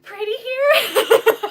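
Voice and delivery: high, stretched-out teary wail through a laugh; retelling sobbing to his mom on FaceTime, high and drawn out; mock sobbing. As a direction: imitate yourself crying at how pretty it is. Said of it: "And I was like".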